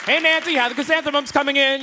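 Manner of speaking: high, loud chirpy neighbor greeting. Retold or imitated. Comic hamming a suburban neighbor, chirpy high call. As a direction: call over the fence like a friendly suburban dad. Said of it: "I got that whole thing going".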